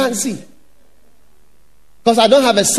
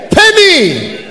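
loud, high demand; mock angry creditor demanding payment; 6 semitones higher, 4 dB louder than usual. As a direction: bark it at the debtor. Wicked servant grabs his throat.

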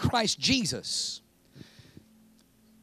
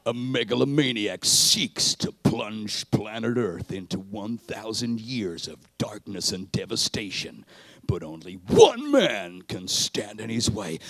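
big movie trailer announcer; 13 semitones lower than usual. Pretend.

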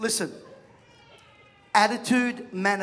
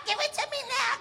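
put-on very high bratty child whine, softer; pleading brat whine; high whiny child, not yet yelling; whining kid; 14 semitones higher, 3 dB quieter than usual. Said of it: "kicking and screaming at his mother".

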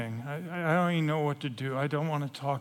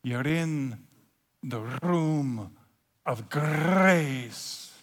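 slow Scottish-style lilt with rolled R's; Scottish or Irish accent; 2 semitones lower, much slower than usual. Acted. Hostess welcoming him to the room, Scottish or Irish accent. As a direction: savor it in a Celtic accent, trilling the R. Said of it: "you can't say it except for in Scottish or Irish".

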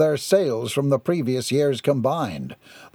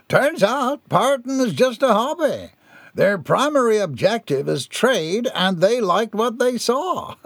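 higher voice, stretching key words; jovial proclaiming captain; 8 semitones higher than usual. A narrator's reading. Captain congratulating Mister Binkley before the crowd, jovial proclamation. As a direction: build to grand congratulations with a chuckle.

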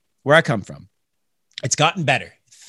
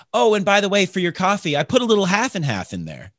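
mock cheerful barista; 7 semitones higher than usual. Barista voiced, half and half.

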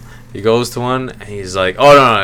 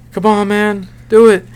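high-pitched mock coaxing; imitating a desperate friend; mock pleading; 11 semitones higher, 4 dB louder than usual. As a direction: beg him to do it, playfully acted. Acted plea in a retold video.

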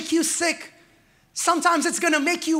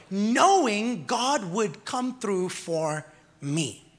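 slow, deliberate emphasis; 4 semitones lower, 4 dB quieter, much slower than usual. Real, not acted. Preacher savouring the word.

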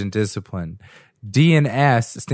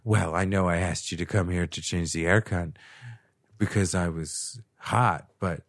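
seductive porn line; 5 semitones lower, 8 dB quieter than usual. Retold or imitated. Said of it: "she's like".

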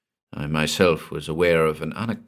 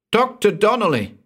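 sharp cry of his name, much higher and louder; alarmed call; 13 semitones higher, 4 dB louder than usual. A narrator's reading. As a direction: call out to him, alarmed and urgent. Evelyn calls out in alarm.

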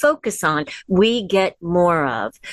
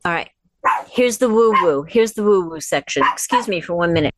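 normal talking voice

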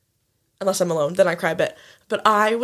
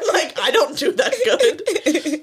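laughing; 7 semitones higher, much slower than usual. Real, not acted. Laughing about crying too much.

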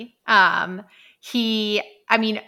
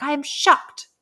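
mock outraged reviewer; 5 dB louder than usual. Hate-mail reviewers attacking Lucy's book, mock outraged and louder. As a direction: ham up a scandalized reader.

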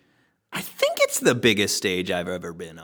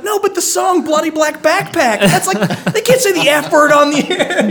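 excited protest; 6 dB louder than usual. Retold or imitated. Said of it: "I'm like".

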